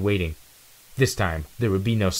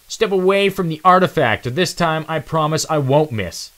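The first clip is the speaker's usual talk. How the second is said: much higher and louder cocky villain; cocky threat; 10 semitones higher, 7 dB louder than usual. Acted. Nitro threatens Zero from the clearing's edge, cocky and loud. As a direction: taunt him from the edge of the clearing. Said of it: "said a cocky voice".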